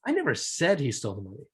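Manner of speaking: said stressed, brisk rest. Shown as a demonstration. Teacher to class, stressing said. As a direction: lean on said. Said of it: "based on how I accentuated that".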